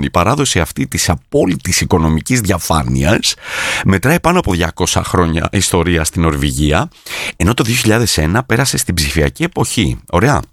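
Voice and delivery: usual speaking voice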